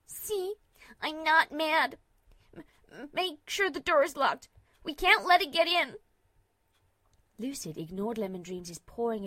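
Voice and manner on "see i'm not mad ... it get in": high, loud frightened pleading; begging in panic; panicked. A narrator's reading.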